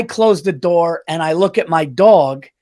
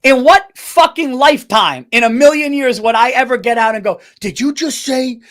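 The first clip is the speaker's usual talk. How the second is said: louder, higher mock outrage; indignant rant, voice up; outraged; 5 semitones higher, 4 dB louder than usual. Real, not acted. Husband venting to friend about wife, loud and higher outrage. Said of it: "outraged rant about wife".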